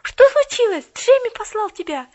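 high, loud, breathless questions; girl rushing in out of breath; eager girl gasping her questions. A narrator's reading. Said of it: "Задыхаясь".

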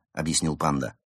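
usual speaking voice

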